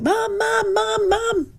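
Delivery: lightly imitating a girl calling from the bath; child calling mum. Storyteller imitating the little girl calling her mum, high mock call. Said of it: "she started yelling".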